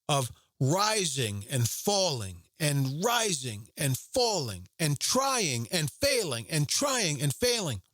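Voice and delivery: slow, drawn-out listing; slowed emphasis. Real, not acted. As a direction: stretch each word to show the endless cycle. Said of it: "sermon, drawn out".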